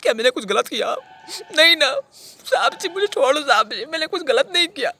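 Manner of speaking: high, loud, whining wail; desperate pleading. Acted. Killer begs officer to let him go, high frantic plea. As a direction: beg frantically to be believed.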